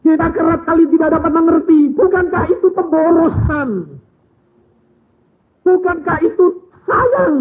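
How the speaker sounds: high, loud, driving exhortation; loud pulpit questions; preaching at full force. Acted. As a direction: hammer the question home to the congregation. Rising sermon exhortation.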